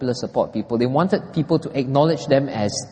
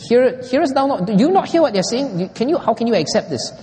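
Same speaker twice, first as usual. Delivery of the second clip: higher, indignant religious leaders; indignant challenge; 9 semitones higher than usual. Retold or imitated. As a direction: protest sharply, scandalized. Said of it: "they were sore displeased".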